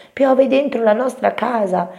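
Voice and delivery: high, loud little mouse; alarmed small mouse announcing trouble; Topino che grida. A narrator's reading. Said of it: "gridò Topino". (vocina acuta e allarmata)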